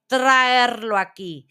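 loud, slow, stretched-out command; witch's shouted order. A narrator's reading. Grand Witch demanding the captured boy, shouting. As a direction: shout it imperiously, dragging the words. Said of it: "gritó la gran bruja".

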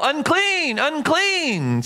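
high, drawn-out leper's warning cry; mock yell. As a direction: call it out high and long, like a warning. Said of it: "they would have to yell".

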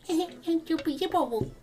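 high timid goblin giggle; squeaky nervous little laugh. Acted. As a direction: giggle like a shy frightened goblin. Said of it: "smiles nervously and goes".

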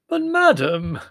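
loud, high wail of despair; anguished wail. A narrator's reading. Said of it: "he wailed in genuine anguish".

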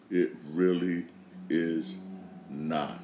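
slow emphasis. Real, not acted. Slow emphatic repeat, pitch sinking.

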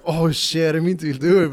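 high, loud blurted apology; acted startled apology. Barber retelling his apology after snapping a towel, startled and loud. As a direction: blurt it like you just hurt a friend.

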